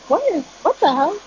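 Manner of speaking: retold high-pitched bewilderment; baffled disbelief. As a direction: act out a baffled reaction, pitch jumping up. Retelling own baffled reaction.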